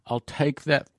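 ordinary delivery

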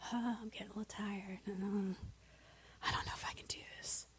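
tired, whispered self-doubt; barely audible weary whisper; sneaky inner voice. Acted. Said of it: "it is sneaky and subtle".

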